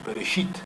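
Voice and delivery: "Bereshit" with the final "shit" stressed and lifted; stress on last syllable. Shown as a demonstration. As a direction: put the voice's weight on the end of "Bereshit". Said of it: "ahí pongo el tono de la voz".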